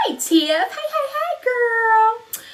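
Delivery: loud, high-pitched squeal of hello; real whooping, high excited hey; excited greeting. Real, not acted. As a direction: shriek a hello to a friend just arriving. Greeting an arriving viewer.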